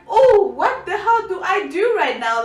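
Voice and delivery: high, loud flustered outburst; mock panic. Not knowing how to react to father, mock panic. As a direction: blurt it out in playful alarm.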